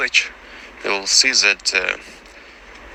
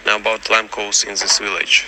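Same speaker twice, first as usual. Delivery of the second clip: normal talking voice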